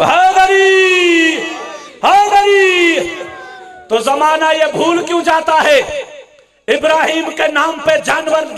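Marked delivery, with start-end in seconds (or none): 0.0-3.0 long, high shouted call; rallying cry. Real, not acted. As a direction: stretch the cry out to rouse the crowd. Preacher leads crowd chant.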